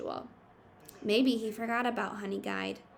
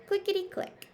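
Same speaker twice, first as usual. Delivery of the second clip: high, crisp clicking word; rattling reeds; 11 semitones higher than usual. Acted. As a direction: click it out sharply. Sound word in picture book.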